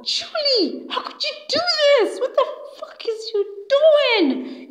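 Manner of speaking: very high, outraged wail of accusation; screaming insult. Retold or imitated. Harriet confronting Julie and Fred, high screaming outrage. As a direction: shriek the accusation in a high acted voice. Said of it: "screaming and insulting them".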